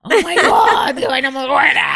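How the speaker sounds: shouted with delight, high and loud; excited shout. Retold or imitated. Aunt greeted by running nephews, shouting with joy. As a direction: burst out in joy like the kids are hugging you.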